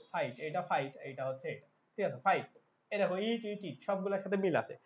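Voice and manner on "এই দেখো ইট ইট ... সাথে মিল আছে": ordinary talk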